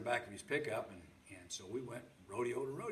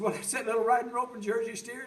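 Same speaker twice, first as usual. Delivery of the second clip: upset protest; 12 semitones higher, 11 dB louder than usual. Retold or imitated. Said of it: "Oh, I was so upset".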